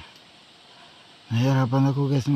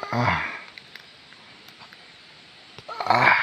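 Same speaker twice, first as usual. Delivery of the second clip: pained groan; 3 semitones lower than usual. Real, not acted. Trapped under truck, hurt back.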